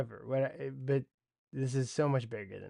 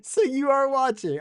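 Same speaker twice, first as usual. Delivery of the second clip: higher and louder, thrilled surprise; surprised delight; 13 semitones higher, 8 dB louder than usual. Real, not acted. Streamer realises his friend is watching live, delighted surprise. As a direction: caught off guard, delighted.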